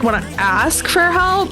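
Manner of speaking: ordinary talk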